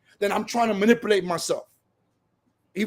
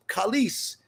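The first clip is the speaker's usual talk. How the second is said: ordinary talk, nothing marked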